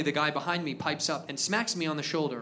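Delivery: ordinary delivery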